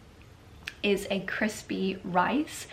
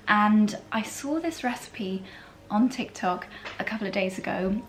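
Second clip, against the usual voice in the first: normal talking voice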